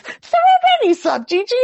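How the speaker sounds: put-on very high, loud child voice; squeaky little girl. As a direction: squeak it like a giggly four-year-old. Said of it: "She goes".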